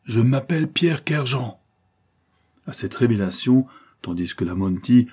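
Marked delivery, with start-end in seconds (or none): 0.0-1.6 high, ringing self-introduction; dramatic reveal in a raised voice; solemn announcement. A narrator's reading. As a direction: declare your name commandingly. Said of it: "il ajouta".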